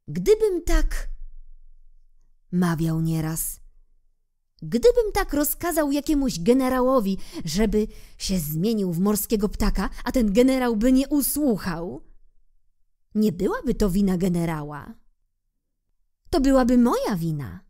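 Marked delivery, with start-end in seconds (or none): 0.1-1.1 musing king. Acted. King muses. 4.6-17.7 reasonable king. Acted. King's favourite saying.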